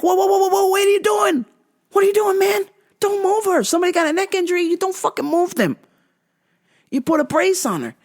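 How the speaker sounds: a mother tearing into a careless medic; full mom mode. Retold or imitated. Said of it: "going into full mom mode, scolding this guy".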